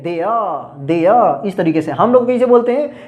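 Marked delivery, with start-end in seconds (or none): 0.0-1.5 देयर said "deah", the r replaced by a short uh; r turned to uh. Shown as a demonstration. Teacher shows the r becoming uh: देयर as "deah". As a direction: soften the end of देयर into "uh", no r. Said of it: "आर को अ बना देते हैं".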